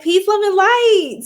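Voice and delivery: high, loud, slow sign-off; sing-song blessing. Real, not acted. Reader blessing her tribe, high and slow. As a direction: stretch it out like a blessing. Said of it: "sign-off blessing".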